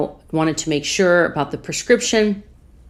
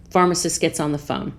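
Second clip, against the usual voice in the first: ordinary talk, nothing marked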